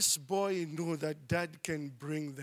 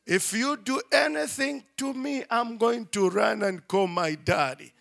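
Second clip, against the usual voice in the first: ordinary talk, nothing marked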